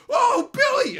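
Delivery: very high, loud hammed-up wail; overacted anguish over a dead son; grief-stricken wail. Father over his fallen kid, hammed-up wail.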